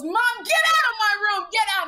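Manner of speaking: high, loud outburst imitating a daughter; mock teen yell. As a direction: yell it like an acted teen tantrum.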